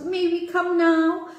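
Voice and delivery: high, eager little birds; eager sparrows. Acted.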